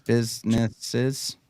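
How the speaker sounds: businesses drawn out very slow with a rising end; slowly spelled out. Shown as a demonstration. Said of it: "Let's do businesses here".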